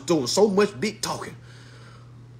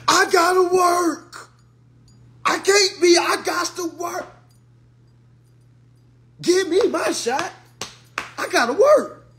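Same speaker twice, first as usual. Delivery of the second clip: higher, louder, slow whining plea; mock whiny plea; 9 semitones higher, 3 dB louder than usual. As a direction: whine like a desperate worker. Mocking parents begging for shot.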